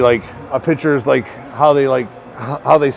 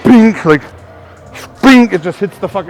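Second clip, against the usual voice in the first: imitated bat tink; 4 semitones higher, 6 dB louder than usual. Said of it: "I'm like".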